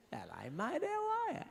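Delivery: hammed-up greeting to a little dog; coaxing a dog. Man trying to pat a snappy dog, mock coaxing squeal.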